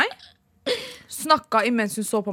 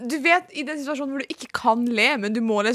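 normal talking voice

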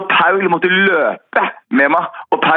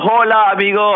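cheery greeting; 6 semitones higher than usual. Retold or imitated. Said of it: "Så sier jeg til han".

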